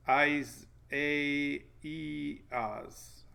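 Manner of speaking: "Eyes, A, E, Oz" as separate, deliberate syllables; syllable-by-syllable pronunciation. Shown as a demonstration. Puzzling out another name from the guide, syllable by syllable. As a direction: sound out "Eyes", "A", "E", "Oz" one at a time. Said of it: "pronunciation guide".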